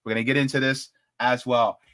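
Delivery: ordinary talk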